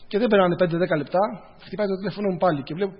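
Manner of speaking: ordinary delivery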